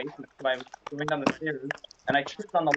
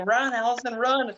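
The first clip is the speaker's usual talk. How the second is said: cheering her on; 9 semitones higher, 4 dB louder, slower than usual. Retold or imitated. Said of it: "She was like".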